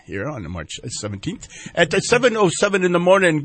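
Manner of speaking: ordinary delivery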